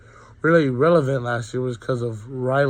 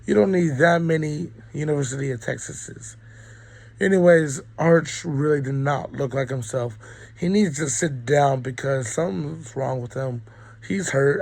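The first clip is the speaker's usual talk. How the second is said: ordinary talk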